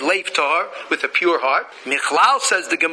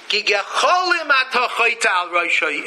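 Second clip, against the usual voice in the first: usual speaking voice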